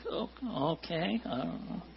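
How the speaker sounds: very soft, hesitant mumble; small, puzzled retold response; bemused reply. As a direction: answer under your breath, unsure. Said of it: "I was like".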